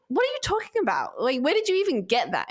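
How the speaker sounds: high, incredulous pushback at an imagined accuser; indignant retort. Retold or imitated. To anyone who blamed her illness on her, indignant disbelief. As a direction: scoff at the idea as if gaslit. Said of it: "I would have felt gaslighted".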